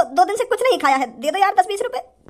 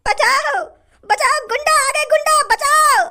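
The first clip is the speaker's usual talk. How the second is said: panicked cry for help; 6 semitones higher than usual. Acted. Victim shrieking for help at the gunman. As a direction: scream for help as if being robbed.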